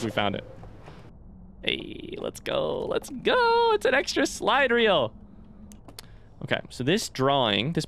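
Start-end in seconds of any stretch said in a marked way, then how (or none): 2.8-5.1 high-pitched thrilled exclamation; excited cheer. Real, not acted. Player finding an extra slide reel, excited cheer. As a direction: celebrate the find, voice leaping high.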